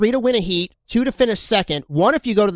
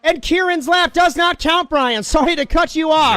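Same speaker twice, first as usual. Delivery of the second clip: excited; 8 semitones higher, 3 dB louder than usual. Real, not acted. Excited commentary on penalty.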